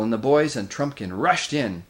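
ordinary delivery

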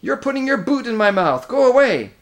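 high, loud, exasperated yell; bellowing. A narrator's reading. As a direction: bellow to get someone off you. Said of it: "bellowed Trumpkin".

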